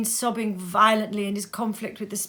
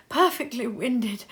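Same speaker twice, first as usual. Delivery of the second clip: usual speaking voice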